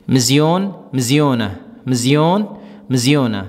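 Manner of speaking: مزیون rising, then مزیونہ falling, twice; drill pair. Shown as a demonstration. Teacher drilling masculine and feminine forms for students. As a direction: chant مزیون and مزیونہ back and forth as a drill. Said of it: "بیچ میں رہنا ہے".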